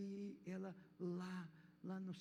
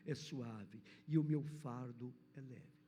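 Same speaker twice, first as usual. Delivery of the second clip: fervent pulpit reading; preaching; 3 semitones lower than usual. Real, not acted. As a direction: proclaim the verses with full conviction, slowing at the end. Preaching a scripture passage.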